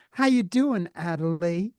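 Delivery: high-pitched Southern twang; hammed-up Southern hello; mock Southern greeting. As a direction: put on a playful South Carolina accent. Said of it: "South Carolina".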